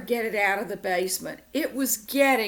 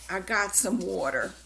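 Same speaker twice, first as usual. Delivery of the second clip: usual speaking voice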